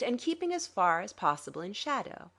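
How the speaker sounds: plain reading voice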